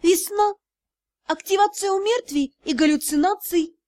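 high, sudden blurt of nonsense. A narrator's reading. Narrator blurting to the staring secretary, high and sudden. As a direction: burst out with it before thinking. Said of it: "оно само как-то вырвалось".

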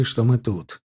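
usual speaking voice

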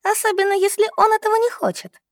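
very high, mock-innocent addition; scoffing. A narrator's reading. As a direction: keep mocking, pitched right up. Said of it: "усмехнулась Лиза". (насмешливо, очень высоко)

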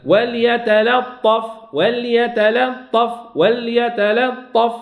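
وليتلطف drawn out slowly, light lam before the ta; slow tajweed demo. Shown as a demonstration. Teacher slowly models وليتلطف for students, careful recitation. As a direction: stretch وليتلطف syllable by syllable. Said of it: "غنشدهاش نقرب شوية".

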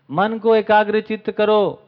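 loud, high, slow book command; declaimed instruction. Acted. Speaker quoting a book to the audience, loud declaimed command. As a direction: call out each word slowly like a written rule.

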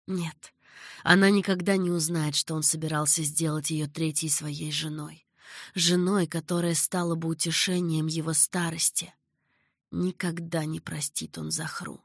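ordinary delivery, nothing marked

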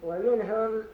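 ordinary talk, nothing marked

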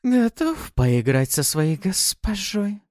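high, slow, teasing; seductive mistress. Acted. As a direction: tempt him playfully. Succubus teases.

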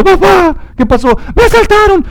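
put-on high, tearful child's voice; crying daughter. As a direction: sob out the news like a robbed little girl. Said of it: "regresa llorando".

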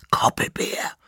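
breathy whispered name; whispering. Acted. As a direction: whisper it into the dark. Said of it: "flüsterte Jekelenar".

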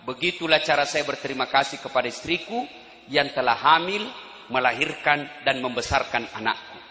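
low, soft, calm reply; Umar. Acted. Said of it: "Dengar jawabannya Umar". (menjawab pelan dan lembut)

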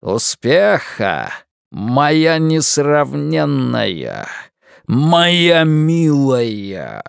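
slow, drawn-out unearthly voice; booming voice. A narrator's reading. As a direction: stretch every word like a demon. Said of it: "прогремел голос".

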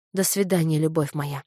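plain reading voice

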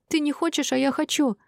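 high-pitched upset cry, little added volume; mildly acted tearful outburst; tearful yell. A narrator's reading. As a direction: protest as if through tears. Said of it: "сквозь слёзы заорала Шинар".

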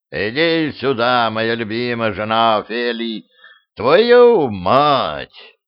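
slurred call turning into a furious curse; drunk angry outburst. Acted. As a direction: drunk king erupts in rage. Drunk king finds daughter, swears.

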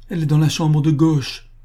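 high, loud, urgent plea; anguished cry. A narrator's reading. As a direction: call out in anguish for help. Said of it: "d'une voix pleine d'angoisse".

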